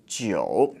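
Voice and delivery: "jiu" dipping down and then up; third tone. Shown as a demonstration. Teacher showing learners number nine, dipping third tone. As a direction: take "jiu" low, then let it rise. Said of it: "That's a third tone again".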